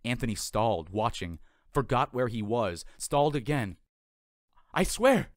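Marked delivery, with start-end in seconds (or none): 4.7-5.3 high, loud exclamation of disbelief. Acted. Anthony stunned by a seagull's dive, loud and high. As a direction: blurt it out loud in amazement. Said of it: "he said out loud".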